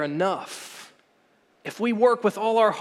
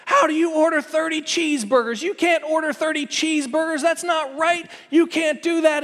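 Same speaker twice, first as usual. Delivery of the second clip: high-pitched imitation of an irate drive-thru worker; mock yelling; 8 semitones higher than usual. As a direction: scold in a raised, put-on voice without full shouting. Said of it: "this lady just starts yelling at me".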